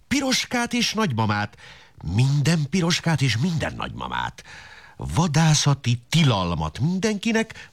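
high-pitched agitated wolf demanding rights. Acted. Wolf demands rights and hunting permits, high and insistent. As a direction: insist like an indignant wolf on the campaign trail.

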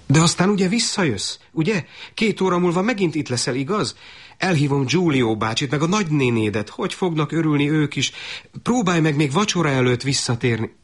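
high-pitched, eager stream of pleading; mother chattering to hold him back; anxious urging. Acted. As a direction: rush on hopefully, voice pitched high. Mother anxiously begs son to return.

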